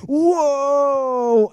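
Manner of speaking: loud high awed whoa; amazed crowd. Acted. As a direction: let out a big amazed whoa. Crowd reacting to descending Messiah.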